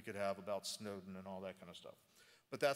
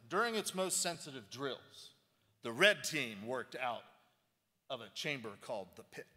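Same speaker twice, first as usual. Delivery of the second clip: reading aloud with theatrical weight; dramatic reading; 3 semitones higher than usual. Shown as a demonstration. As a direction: read it like a movie trailer. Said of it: "which I like to do a dramatic reading".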